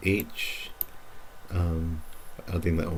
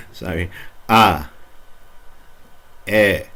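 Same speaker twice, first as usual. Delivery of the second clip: teacher vowel drill; slower than usual. Shown as a demonstration. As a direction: lead the class through the vowels. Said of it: "let's look at these again".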